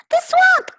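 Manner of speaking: very high, loud shriek; terrified kids. Acted. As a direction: scream it in fear. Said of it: "cried the kids".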